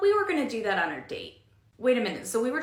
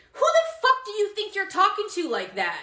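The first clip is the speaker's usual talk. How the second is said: angry retort; 7 semitones higher than usual. Acted. Coworker confronts rude customer.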